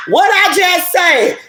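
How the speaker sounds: high, drawn-out crow of triumph; triumphant exclamation. Real, not acted. Reader vindicated by the card, triumphant exclamation. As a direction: crow it way up high, stretching the words.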